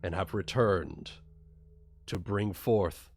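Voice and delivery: low, quiet, reverent smith handing over a blade; solemn old smith. Acted. GM as the smith presenting the sword, low and solemn.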